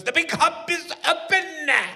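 high, slow, put-on lady voice; TV lady impression. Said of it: "the woman who goes".

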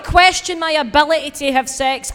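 loud unapologetic defiance; defiant outburst. Real, not acted. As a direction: fire back hard. Defiant about trolls.

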